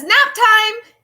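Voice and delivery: high, bright call of nap time; announcing nap time. Acted.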